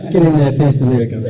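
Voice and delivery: an engineer hollering into the booth; mock bossy shout. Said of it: "He's like".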